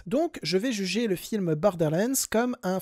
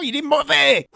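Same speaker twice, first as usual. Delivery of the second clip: exasperated outburst; 6 semitones higher, 8 dB louder than usual. Real, not acted.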